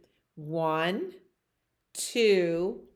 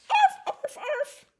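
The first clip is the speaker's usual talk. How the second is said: bark. Acted. Puppy George finally barking, high and happy. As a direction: yip it like a tiny excited pup.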